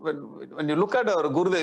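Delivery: normal talking voice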